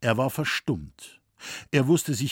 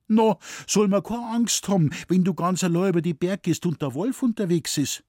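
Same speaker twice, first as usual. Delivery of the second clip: put-on high, quick worried girl's voice; 8 semitones higher, 4 dB louder than usual. A narrator's reading. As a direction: fret aloud about the wolf. Mädchen in Sorge, erregt. (besorgt, hoch und schnell)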